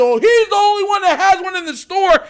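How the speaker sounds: mock cry singling someone out; imitated accusing bystander. Store guy pointing at dad, imitated outcry.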